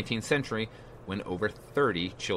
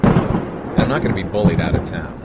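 defiant; 2 semitones lower, 10 dB louder than usual. Acted. Defiant retort to threatening caller.

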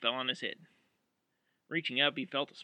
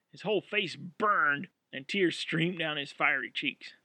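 ordinary delivery

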